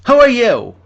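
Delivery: high, startled and defensive; jumpy, nervous old professor; panicked professor. Acted.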